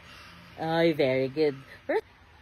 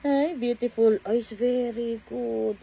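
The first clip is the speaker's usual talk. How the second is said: ordinary delivery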